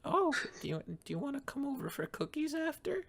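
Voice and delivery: high sweet mom voice; falsetto mom. Acted. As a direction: play a sugary mother high up. Mom invites Ian.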